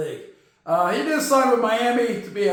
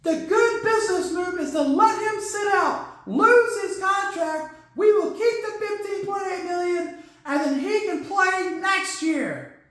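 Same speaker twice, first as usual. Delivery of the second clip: put-on schemer; 8 semitones higher than usual. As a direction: lay out the plan in a high voice. Acts out team's scheming reasoning.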